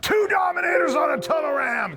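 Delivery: booming monster truck announcer shout. Shown as a demonstration. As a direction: yell it like a Sunday Sunday Sunday ad. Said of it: "you have to go for the monster truck guy".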